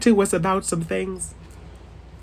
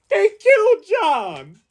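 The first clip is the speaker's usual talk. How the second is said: high, slow, stretched wail on killed; mock anguish; 11 semitones higher, slower than usual. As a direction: cry it out even higher. Acted outrage at John's death.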